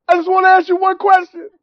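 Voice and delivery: high-pitched imitation of pleading through tears; mock tearful. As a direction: plead in a high, put-on crying voice. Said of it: "So I'm, I'm crying".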